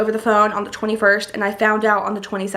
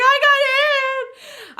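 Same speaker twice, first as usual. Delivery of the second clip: very high, drawn-out tearful squeal; overcome with emotion; much slower than usual. Retold or imitated. Daughter repeating news to mom, emotional high wail. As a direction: wail it slowly, acting out happy tears. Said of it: "I was so emotional".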